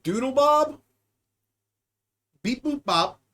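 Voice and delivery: usual speaking voice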